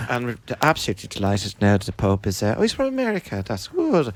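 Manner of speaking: low, soft, solemn newsreader voice; mock serious news voice. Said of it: "Serious voices".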